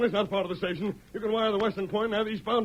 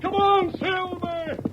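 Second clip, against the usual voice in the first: calling out; 11 semitones higher, much slower than usual. Acted. Urging his horse on.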